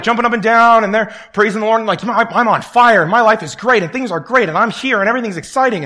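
mock enthusiasm. New students at Moody gushing about faith, hammed-up excitement. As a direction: parody the overeager freshman.

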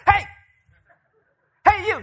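loud, high shout after someone; calling out. Acted. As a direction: holler to stop a man walking off. Acted calling after fleeing man.

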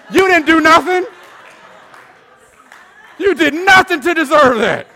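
high, loud indignant preaching; preacher's shouted reproof; loud rebuke. Acted. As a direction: call them out at full volume. Rebukes undeserved praise at full force.

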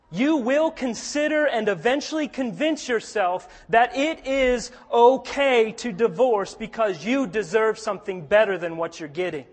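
normal talking voice

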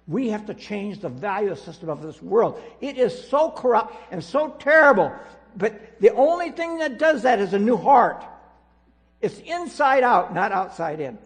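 loud, high, impassioned sermon push; booming pulpit preaching; fiery preaching. Acted. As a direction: preach it hard to the whole congregation. Preaching at full force.

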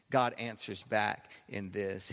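ordinary delivery

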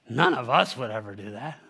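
low, dry sarcasm; sarcastic. Real, not acted. Preacher teasing his congregation about relating, sarcastic. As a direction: say it low, not meaning a word. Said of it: "said sarcastically".

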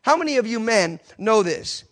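ordinary delivery

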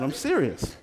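ordinary delivery

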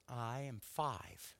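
put-on slow, careful little-girl answer; hint of proud little girl. Lily telling the pastor her age, slow and proud. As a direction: say it like a proud five-year-old. Voicing five-year-old Lily.

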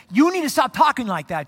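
mock-stern telling-off; retold rebuke. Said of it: "begins to rebuke him".

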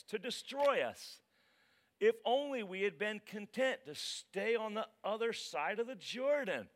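loud, high-pitched plea to God; anguished, dramatic scripture reading; impassioned lament. Acted. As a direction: cry out in protest to the Lord. Joshua's lament to God read dramatically.

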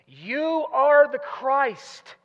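loud, high, long-held "You", slow through the phrase; emphatic stress on You. Acted. Peter answering Jesus, emphatic long stress on "You". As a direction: proclaim it, stretching "You". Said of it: "emphasizes the exact same word".

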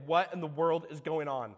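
normal talking voice

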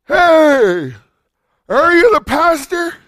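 loud, high mock drunk voice; drunk man calling out. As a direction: call out like a tipsy stranger.

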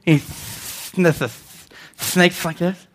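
soft, hissing snake voice; cartoon snake. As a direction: draw out the hissed sounds slowly. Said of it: "remember the snake?".

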